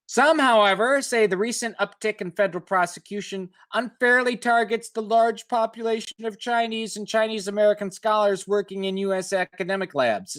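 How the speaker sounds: article read aloud. Acted. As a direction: read it like a radio feature story. Reads article text aloud.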